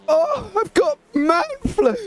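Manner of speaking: mock a self-pitying sick man; whiny sick voice. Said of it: "open it up with".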